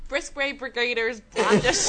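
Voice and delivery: loud put-on D4 accent, on the edge of laughter; hammed-up Dublin D4 voice. Contestant starting a tongue twister in a loud mock D4 accent. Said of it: "In your best D four accent".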